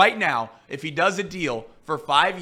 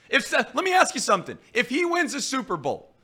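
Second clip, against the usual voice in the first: ordinary delivery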